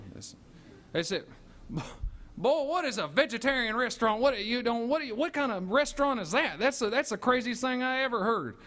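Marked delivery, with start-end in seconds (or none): 2.4-8.6 mock southern cop. Officer voiced mocking vegetarian restaurant.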